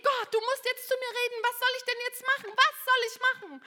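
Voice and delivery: reliving a desperate prayer, high and pleading; desperate plea. Retold or imitated.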